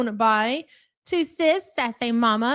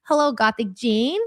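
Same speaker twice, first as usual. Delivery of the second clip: ordinary talk, nothing marked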